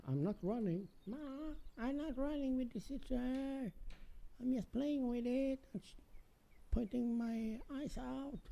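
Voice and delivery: put-on high-pitched kid voice; child voice. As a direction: play a defiant little kid, high. Child and mom scissor skit.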